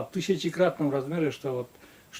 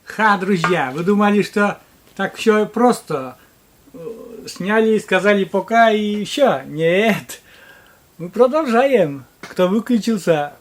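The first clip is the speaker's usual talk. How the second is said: excited; 6 semitones higher, 7 dB louder, slower than usual. Real, not acted. Fake outro, then excited return.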